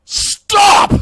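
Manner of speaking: very high, loud mock yell; imitated scream. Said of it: "he just screams".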